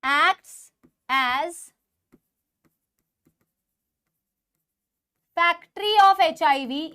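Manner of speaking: very slow, word-by-word dictation. Real, not acted. Teacher dictating a note to students, slow dictation. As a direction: dictate slowly, pausing long for note-takers.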